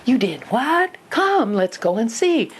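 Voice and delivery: high, stretched-out delighted disbelief; excited parent. Shown as a demonstration. Parent hears child made the bed, excited. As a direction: act amazed, slowly drawing out the invitation. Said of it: "you gotta get excited here".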